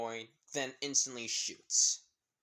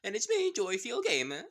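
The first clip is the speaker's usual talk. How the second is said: high-pitched over-the-top intro; hammed-up greeting; 10 semitones higher, 5 dB louder than usual. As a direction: sing out a big theatrical welcome. Hammed-up channel greeting to viewers.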